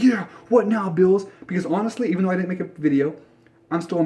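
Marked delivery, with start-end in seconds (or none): 0.0-1.3 higher, louder mock gloat; sarcastic imitation of a gloating fan; mock boasting. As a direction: gloat at Bills fans, hammed up. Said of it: "I'm not here boasting like".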